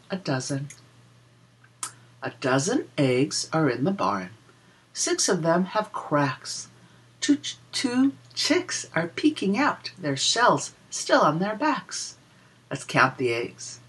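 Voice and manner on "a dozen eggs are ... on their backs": put-on lilting read-aloud for small children; a sing-song rhyme for little listeners; reading a picture book rhyme. Reads rhyming counting book verse.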